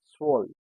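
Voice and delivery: swollen said as German zwölf; German pronunciation. Shown as a demonstration. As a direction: say swollen as one syllable, "tsvölf". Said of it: "sounds as S".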